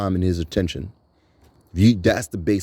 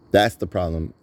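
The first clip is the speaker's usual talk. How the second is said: normal talking voice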